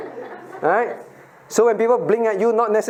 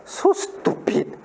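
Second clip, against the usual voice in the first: muttered scorn; 5 semitones higher, 4 dB quieter, much slower than usual. Retold or imitated. Acts cross-eyed scorn.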